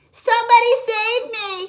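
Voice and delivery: high, loud shout; stranded frog shouting for help. Acted. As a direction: yell for rescue. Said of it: "he shouted".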